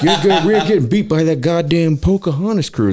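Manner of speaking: loud, high-pitched imitated rant; hammed-up irate foreman; worked-up boss. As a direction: goad the crew in outraged disbelief. Boss ranting about rival crew.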